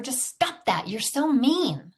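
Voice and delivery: high, slow scolding mom; hurt scolding parent. Retold or imitated. Mom scolding son for being mean, stung. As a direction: drag out the reproach like a stung parent.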